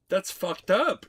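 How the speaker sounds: high loud indignation; outraged. Real, not acted. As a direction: exclaim it with mock outrage. Reacting to spiders not paying rent.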